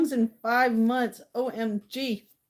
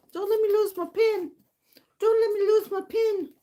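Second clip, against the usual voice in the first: high, louder mock plea; playful exclaiming; 10 semitones higher, 4 dB louder than usual. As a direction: cry it out high and playfully insistent. Mock pleading, repeated louder.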